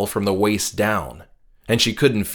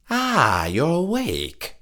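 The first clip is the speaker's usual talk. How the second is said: slightly nasal, slow and gentle; nasal man's voice; 8 semitones higher, slower than usual. Acted. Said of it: "came a slightly nasal voice".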